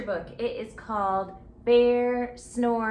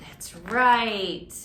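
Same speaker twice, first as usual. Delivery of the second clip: ordinary delivery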